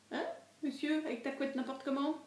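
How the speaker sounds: talking to a dog. Real, not acted. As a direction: tease the dog gently. Teasing the dog.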